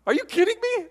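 high, squeaky mock disbelief; preacher mocks awe at fireworks in high disbelief. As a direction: pretend to be outraged at fireworks fans. Mocking firework oohs and ahs.

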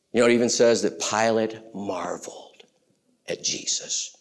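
soft, breathy whisper of wonder; a whisper of awe; hushed whisper. Real, not acted. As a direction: lean in and whisper. Preacher whispers Pilate's amazement.

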